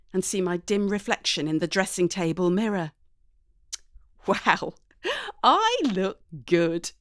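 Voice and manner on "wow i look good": pleased with herself. A narrator's reading. Admiring herself in mirror.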